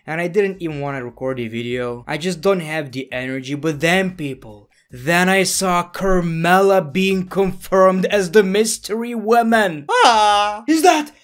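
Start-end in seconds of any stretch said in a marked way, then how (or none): 4.9-11.1 high, loud fangirl outburst; excited. Acted. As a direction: build to an ecstatic, high-pitched shout. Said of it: "I'm so excited".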